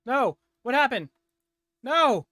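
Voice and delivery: real high, loud cries of disbelief; dismayed shouting. Real, not acted. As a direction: cry out in shocked protest. Game dies on player mid-fight.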